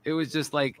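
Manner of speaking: ordinary talk, nothing marked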